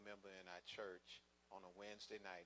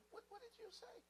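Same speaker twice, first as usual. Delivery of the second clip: stunned disbelief; 6 dB quieter than usual. Retold or imitated. Stunned by news of death.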